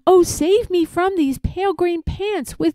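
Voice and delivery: high, pleading cry for rescue; terrified plea, pitched high; frightened cry. A narrator's reading. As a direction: beg to be saved in a high panicked voice. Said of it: "I cried".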